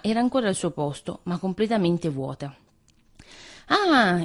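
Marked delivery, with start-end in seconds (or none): 3.7-4.2 exclaiming. A narrator's reading. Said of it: "esclamò il topo".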